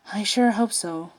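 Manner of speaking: ordinary delivery, nothing marked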